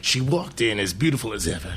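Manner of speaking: low, hushed noir narrator; noir detective. Pretend. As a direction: murmur it like a hard-boiled detective. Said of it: "film noir monologue".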